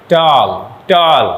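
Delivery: "টল" drawn out in a single beat, emphatically; one-syllable demonstration. Shown as a demonstration. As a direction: say "টল" as one long, unbroken syllable. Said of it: "উচ্চারণ করি".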